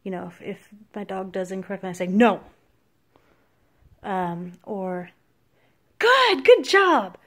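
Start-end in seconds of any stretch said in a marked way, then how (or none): 2.1-2.4 loud sharp scolding no; harsh no. Shown as a demonstration. Trainer scolding a dog that did wrong, loud. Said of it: "if you use different tones". 6.0-7.2 high, slow gushing praise; shower the dog with giddy praise; excited praise. Shown as a demonstration. Trainer praising a dog, high and excited. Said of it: "if you use different tones".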